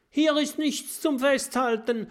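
high, panicked shout; nothing to grab and scared. A narrator's reading. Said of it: "schreit Mathilda".

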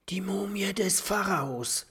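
high, breath-filled whisper; excited whisper. A narrator's reading. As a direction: whisper it thrilled and awed. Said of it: "flüstert Kokosnuss aufgeregt".

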